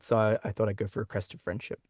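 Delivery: usual speaking voice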